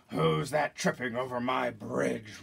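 slow troll roar, lightly voiced. Troll challenging a small goat on the bridge, mild roar. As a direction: growl it out slowly like a troll guarding its bridge. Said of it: "roared the troll".

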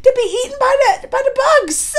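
high, loud, laughing disbelief; incredulous. Real, not acted. Vlogger recounting a father cursing his son, high-pitched and incredulous. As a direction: exclaim it as if scandalized, pitch way up.

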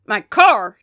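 loud, frantic dad; frantic cry. A narrator's reading. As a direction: cry out over your wrecked car. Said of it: "Dad cried frantically".

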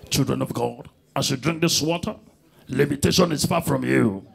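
low solemn preacher voice imitating the pastor; pastor's prayer. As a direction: intone it like a pastor blessing water. Comedian mimics pastor praying over water.

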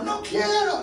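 high mock wife voice; lightly voiced wife refusing; wife's refusal. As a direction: pout it out like the wife.

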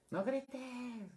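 high-pitched shrieked no grites; teacher mimicking a mother yelling at her child not to yell; mock yelled command. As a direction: shriek it like a frazzled mom, ironically yelling. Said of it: "Más gritando".

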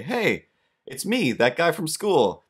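high, slow, sing-song hello from the window; neighbor's greeting. Retold or imitated. Neighbor leaning out the window greets them in a high, slow voice. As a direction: stretch out a goofy high greeting.

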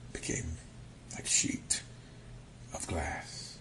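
soft hushed whisper. A narrator's reading. Describing the sea gone glassy calm, hushed whisper. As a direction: hush your voice like still water.

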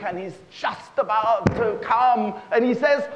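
ordinary delivery

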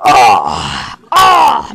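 a kid's hammed-up cry of disgust; disgusted outcry. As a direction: wail it out in disgust. Disgusted at a toy smell.

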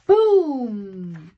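loud burst like a thunderclap; booming thunder. A narrator's reading. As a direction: hit it like thunder. Thunder in the story.

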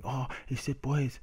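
high-pitched mocking complaint; mock offence. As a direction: mimic someone making a fuss over nothing. Mimics the offended players' complaint.

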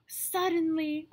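gasp, then a high, tense "Suddenly"; sudden alarm; dramatic gasp. Acted. Storm arrives.

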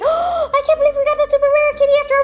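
high-pitched, breakneck excited squeal; thrilled. Real, not acted. Unboxer finally getting the super rare kitty, fast excited squeal. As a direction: gush it all out in one fast, high rush. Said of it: "I'm so happy".

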